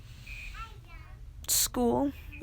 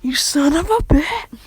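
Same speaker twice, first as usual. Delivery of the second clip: loud, slow, higher angry yell; furious outburst; 5 semitones higher, 11 dB louder, slower than usual. Acted. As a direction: spit it out in slow rage. Character finds Seven with thirteen.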